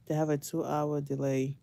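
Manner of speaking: ordinary talk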